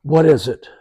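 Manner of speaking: a touch of suspicion, bracing for bad news; cynical. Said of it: "real cynical".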